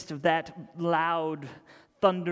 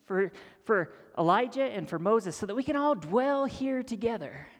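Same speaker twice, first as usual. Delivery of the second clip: eager Peter; 6 semitones higher, 4 dB louder than usual. Retold or imitated. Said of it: "he says".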